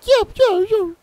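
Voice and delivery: very high squeaky whining yips. Retold or imitated. Gamer imitating the Pokemon's panicked whining with very high squeaky yips. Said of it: "like a whining".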